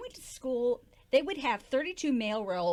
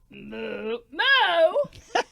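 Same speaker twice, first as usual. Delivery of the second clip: far-off mock moo rising loud; parrot mooing; 6 semitones higher, 8 dB louder than usual. Puppet parrot moos instead of singing, mock moo. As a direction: moo like a cow, the second one big and high. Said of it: "you're not a cow".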